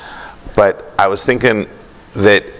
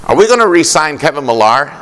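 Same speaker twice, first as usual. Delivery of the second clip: put-on pitched up, bright question of a young fan; eager little boy; 7 semitones higher, faster than usual. Little boy asking the team executive, in an eager higher voice. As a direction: ask it the way a keen kid asks about his team.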